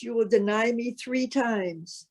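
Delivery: normal talking voice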